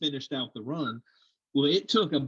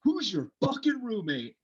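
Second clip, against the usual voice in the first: angry yelling; 8 semitones higher than usual. Retold or imitated. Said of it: "And they were like".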